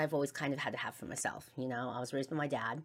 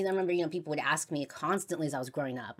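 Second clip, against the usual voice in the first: ordinary talk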